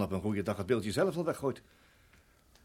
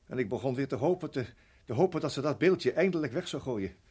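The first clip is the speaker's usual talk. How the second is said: ordinary talk, nothing marked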